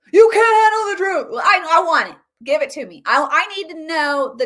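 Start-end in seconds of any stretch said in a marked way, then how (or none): 0.1-1.4 louder, higher mock courtroom yell; shouted movie quote. Commentator throws in the famous film line, shouting.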